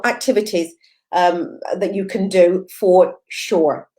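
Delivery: normal talking voice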